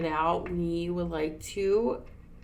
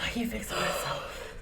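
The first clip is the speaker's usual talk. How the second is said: whisper. Real, not acted. Aside while laughing.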